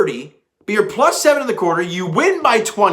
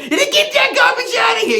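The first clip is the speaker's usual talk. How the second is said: high, shouted New York broadcaster bark; New York accent. Shown as a demonstration. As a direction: belt it out as a thick New York impression. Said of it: "gotta give it that New York accent".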